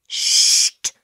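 loud, sharp shush; long hissed "shh". A narrator's reading. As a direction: hush someone talking out of turn. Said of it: "machte Misses Blocksby".

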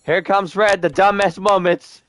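mock taunting. Self-mocking taunt at his blunder.

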